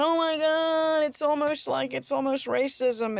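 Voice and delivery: a hammed-up outraged whine; mock whining. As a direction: whine it like a scandalized complainer. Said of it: "bitching and moaning".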